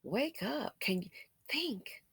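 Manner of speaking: very soft, falling to a whisper; hushed nudge to wake up and think; hushed urging. Real, not acted. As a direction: drop right down to a near-whisper. Urging listeners in a hush.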